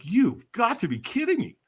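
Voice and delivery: high-pitched disbelief; incredulous inner voice. Retold or imitated. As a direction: protest like a baffled brain. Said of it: "my brain is like".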